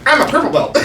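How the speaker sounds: high whiny mock boast; mocking brag. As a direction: ridicule his bragging. Mocking purple belt guy.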